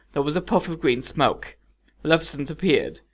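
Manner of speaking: ordinary delivery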